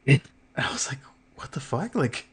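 breathy whispered disbelief. Retold or imitated. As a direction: whisper it in shock. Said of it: "whispered disbelief".

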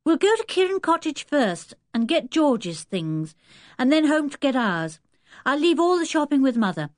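put-on high, animated boy's voice; eager Dick. Dick lays out the errands to Julian, eager.

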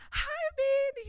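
very high little squeal of hello; gushing fan greeting. Retold or imitated. Retold fan greeting idol.

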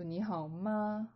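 你好吗 with ma rising at the end; rising question tone. Shown as a demonstration. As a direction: lift the pitch on the final 吗. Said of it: "raise it at the end of the word".

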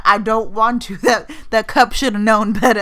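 playfully stubborn, loud and giggly; loud laughing defiance. Retold or imitated. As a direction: refuse loudly through laughter. Retelling her refusal, laughing.

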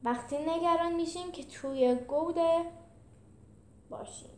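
lightly acted grumble; grumbling. A narrator's reading. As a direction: grumble it, trailing off at the end. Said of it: "جولیوس قرقر کرد".

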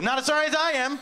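sarcastic funeral comeback, pushed up high; real sarcastic. Retold or imitated. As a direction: throw it back with mock bitterness. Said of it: "And, like, real sarcastic".

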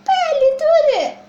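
high, loud yelp of pain; pained cry. Acted. Baby elephant crying out in pain to the doctor. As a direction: cry out that it hurts.

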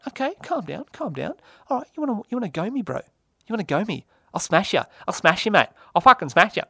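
high, cocky pub brawler taunt; mock tough guy. Mock fight with cohost over a read.

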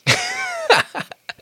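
sudden high-pitched burst of laughter; big real laugh. Real, not acted. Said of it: "that one made me laugh pretty hard".

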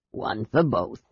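put-on old sailor offering gifts to the knights, gummy and humble; toothless old sailor. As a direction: mumble like a toothless old seaman.

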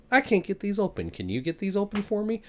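a hint of a helpless clerk asking for help; mock cashier. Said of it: "checkout lady looks and says".

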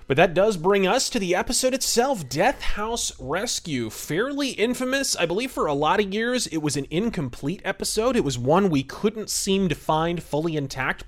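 ordinary talk, nothing marked

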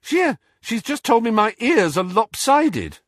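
high, loud indignation; indignant young man. A narrator's reading. Twin complaining about great-aunt's insult, high and indignant.

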